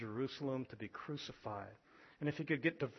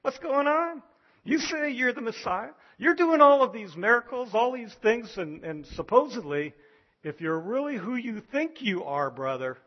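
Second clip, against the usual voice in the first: loud, high jeering brothers egging him on; mocking taunt; 7 semitones higher, 9 dB louder than usual. Brothers mocking Jesus to prove himself, loud jeering taunt. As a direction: goad him like scornful brothers daring him. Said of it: "They're mocking".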